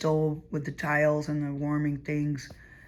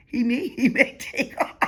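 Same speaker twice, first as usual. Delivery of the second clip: laughing; 5 semitones higher than usual. Real, not acted. Laughs imagining him flying off.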